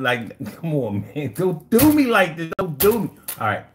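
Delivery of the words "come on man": ordinary delivery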